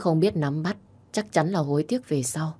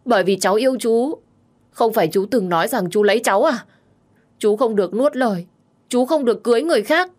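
higher, pleading, impassioned voice; insistent young woman in love; 6 semitones higher, 4 dB louder, faster than usual. Acted. Tipsy girl confesses love to her uncle, impassioned.

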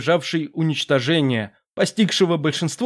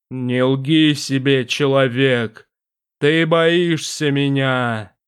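low, slow, echoing demon voice; a vast presence speaking from the dark; booming demon voice; 3 semitones lower, much slower than usual. Acted.

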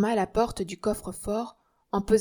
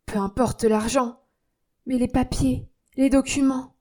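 cry out; 4 semitones higher, 4 dB louder than usual. Acted. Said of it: "s'écria".

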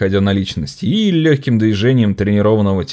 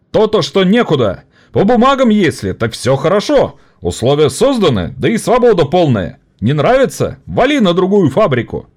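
higher, sarcastic rally cry; orator mocking the bosses; 10 semitones higher, 4 dB louder than usual. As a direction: jeer at the paperwork. (насмешливый оратор)

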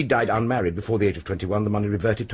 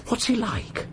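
quick startled question, pitched up high; sharp surprise; 14 semitones higher, slower than usual. Acted. Police doctor startled at the name of Venables, sharp high surprise. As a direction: jump on the name, suddenly alert. Sudden urgent interest in Venables.

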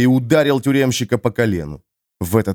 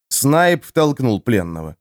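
usual speaking voice

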